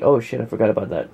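usual speaking voice

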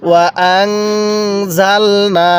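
very slow chant with the ghunnah held long; slow nasal-held recitation. Shown as a demonstration. Teacher models the ghunnah for students, slow chant. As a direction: hum the nun through the nose, drawn out. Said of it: "কিভাবে পড়ব?".

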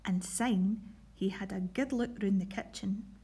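put-on lively read-aloud storytelling for children; reading a Scots picture book to pupils; story narration. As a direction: tell it brightly to a school assembly. Teacher reads Scots story aloud.

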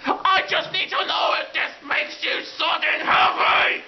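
shouted, exasperated tirade; comic screaming rage; angry rant. Acted. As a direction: harangue him at the top of your voice. Acted quarrel with the creator.

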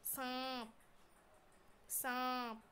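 सांप with the आं said through the nose; सांप, nasalised long आ ending in प; nasal vowel demo. Shown as a demonstration. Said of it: "अब बोल के देख लीजिए". (सांप में आं नाक से बोलिए)

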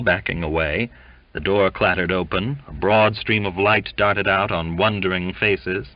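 ordinary delivery

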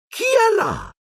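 very high-pitched sprite calling out; mischievous poltergeist. A narrator's reading. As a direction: squeal it suspiciously into the dark. Peeves senses intruders, calls out. (spiritello maligno, voce acutissima)

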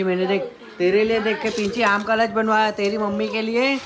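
sweet talk to a pet bird. Real, not acted. Talking to the pet in baby voice.

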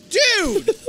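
very high, loud protest; shocked yell. Real, not acted. Player sees figure flung away, shocked yell. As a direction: yell out in disbelief.

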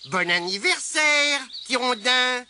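loud, high birthday cheer; trumpeted greeting. A narrator's reading. Spider wishing Tirondin happy birthday, trumpeted. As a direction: sing it out proudly, ringing. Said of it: "claironna Abigail".

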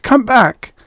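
high loud urgent call. A narrator's reading. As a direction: shout after the running child. Mother calling to running boy.

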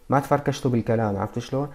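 ordinary talk

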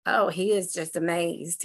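ordinary talk

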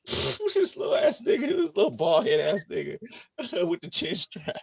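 mock Tariq, cracking up mid-line; laughing mock insult. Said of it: "Tariq was like".

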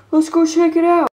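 normal talking voice

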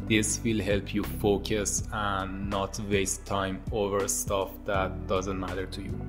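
ordinary talk

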